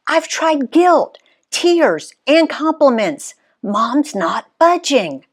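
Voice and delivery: put-on high, slow, a bit deflated kid sister; Riley's voice. A narrator's reading. Riley's voiced line, sister character.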